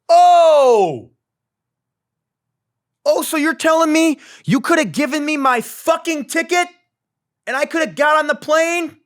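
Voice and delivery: full-volume meltdown scream; furious shout. Retold or imitated. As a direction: yell it across the airport counter. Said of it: "at the top of my lungs".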